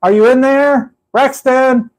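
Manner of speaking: high, playful call through a car trunk; teasing call to someone inside. Shown as a demonstration. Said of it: "like that".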